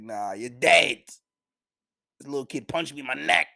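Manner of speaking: loud, slow mock bandit menace; bandit threat. Higuma telling the mayor the kid is dead, mock menace. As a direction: lean into a hammed-up bandit threat.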